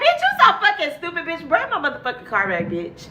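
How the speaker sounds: high, fast furious cussing at a prankster; accusing friend on the phone, fast angry; livid phone rant. Retold or imitated. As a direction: snap at her, rapid and high. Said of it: "I was fucking livid".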